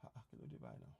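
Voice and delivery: very soft, low aside; muttering to self. Real, not acted. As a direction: talk to yourself under your breath. Muttering to self while fixing screen.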